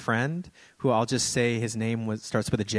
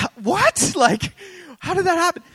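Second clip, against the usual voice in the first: much higher, louder incredulous cry with a laugh; baffled disbelief; 9 dB louder than usual. Retold or imitated. Pastor recalling the lady blessing his friend, loud disbelief. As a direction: react in stunned disbelief. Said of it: "it's like".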